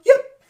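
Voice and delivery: sudden high loud yip at the end; high yelp. Shown as a demonstration. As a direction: yelp it sharply upward. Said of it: "imagine if a singer did that".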